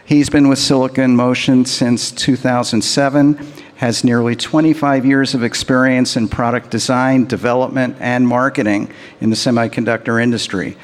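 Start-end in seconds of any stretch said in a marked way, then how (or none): none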